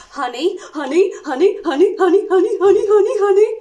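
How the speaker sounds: greedy rising frenzy over the honey; honey-crazed. Acted. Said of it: "couldn't help himself".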